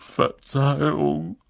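slow, choked sorry; a mild tearful sorry; sobbing apology. A narrator's reading. Hagrid apologising to McGonagall through mild sobs. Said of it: "schluchzte Hagrid".

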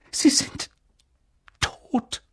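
breathless whisper; barely able to say it. Acted. Professor telling Dumbledore the Potters are dead, in a whisper.

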